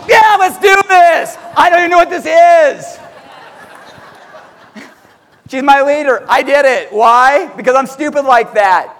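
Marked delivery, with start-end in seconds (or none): 0.1-3.0 hammed-up gung-ho yell; mock eager shout. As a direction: yell it like a clueless fan. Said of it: "I'm like".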